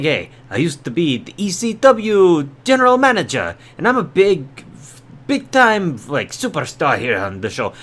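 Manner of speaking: put-on botched Cuban accent that comes out Italian; bad Cuban accent. Ousted manager flirting with the assistant, badly faked Cuban-Italian accent. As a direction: attempt a Cuban accent, land on mock Italian. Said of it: "I'm speaking with an Italian accent".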